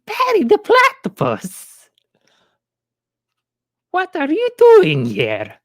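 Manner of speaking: very high, slow, strained cartoon villain cry; Doofenshmirtz voice. Streamer to chat, doing the villain's shrill slow cry. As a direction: shriek it like a cartoon mad scientist spotting his nemesis. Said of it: "I was gonna do the voice".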